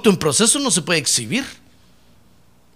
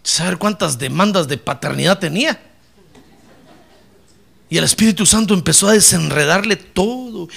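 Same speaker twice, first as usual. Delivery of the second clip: ordinary talk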